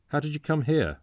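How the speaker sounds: agitated stranger demanding an answer; quick, excitable. A narrator's reading. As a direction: ask it urgently, almost passionately. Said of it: "asked the stranger quickly, excitably, passionately almost".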